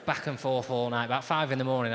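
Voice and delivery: usual speaking voice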